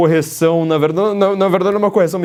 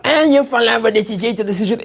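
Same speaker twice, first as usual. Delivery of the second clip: high, squeaky put-on little voice, self-mocking; imitating Lula mocking himself with a silly high voice; Lula's little voice; 8 semitones higher than usual. Commentator imitating Lula mocking his own speech, high squeaky little voice. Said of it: "Ele faz uma vozinha".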